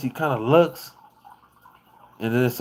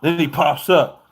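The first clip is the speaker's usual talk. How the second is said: ordinary delivery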